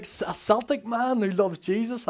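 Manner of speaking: high, slow incredulous question; mock astonishment, drawn out; amazed disbelief. Retells surprise.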